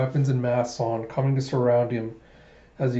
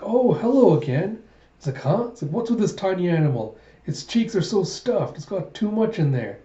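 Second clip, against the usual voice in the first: delighted cooing; 6 semitones higher than usual. Acted. Princess delighted by the squirrel, cooing high. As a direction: coo over something tiny and adorable.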